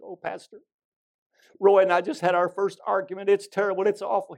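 high, loud mock wail of a distraught bride; mock sobbing. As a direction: wail it as an overwrought young wife, hammed up. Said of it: "she was just sobbing, boo-hooing, just sobbing".

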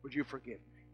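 very soft, low plea; a hushed, humble request; father asking his son for forgiveness, very softly; apology to son. Retold or imitated. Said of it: "I look at him and say".

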